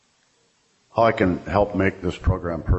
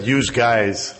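New York "yous guys"; 5 semitones higher than usual. Pretend. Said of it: "I should have said".

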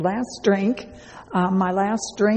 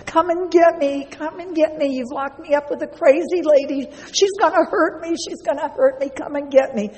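high, frantic mock yell for help; retold yelling; 8 semitones higher than usual. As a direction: cry out to be rescued, pitch way up. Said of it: "I started yelling".

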